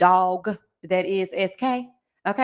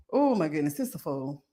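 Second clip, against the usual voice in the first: usual speaking voice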